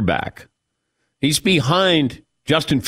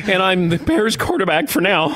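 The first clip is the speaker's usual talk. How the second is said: rising higher toward a gushing finish; mock excited; 8 semitones higher than usual. Host mimics Foles flirting with the Colts, mock gushing. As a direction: build to a mock lovestruck gush. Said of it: "getting more and more excited".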